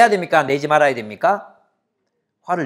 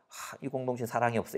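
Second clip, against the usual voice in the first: softly voiced grumble, pitched lower; quiet complaint; 4 semitones lower, 14 dB quieter than usual. Member complaining the community has no love, quiet grumble.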